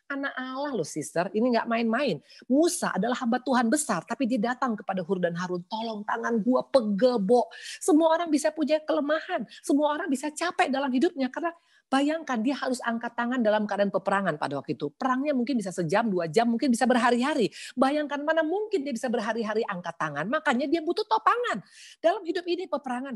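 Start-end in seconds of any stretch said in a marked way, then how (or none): 0.1-4.8 high, urgent sermon voice; preaching earnestly to the sisters; fervent preaching. Acted. Opening exhortation at high pitch. 5.7-7.5 slow, higher weary plea; lightly voiced tired Moses; Moses pleading. Voicing Moses asking for help. 7.8-23.1 high, driving sermon voice; fervent pulpit preaching; preaching at full force. Acted. High-pitched preaching run.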